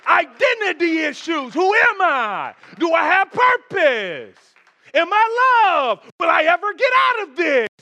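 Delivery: high, loud, anguished cries of doubt; preaching at full force. Acted. Preacher crying out the questions of a lost man.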